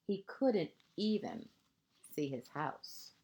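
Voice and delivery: lilting picture-book narration, phrase ends hushed; reading to children. A narrator's reading. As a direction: read it like a bedtime story to a small child.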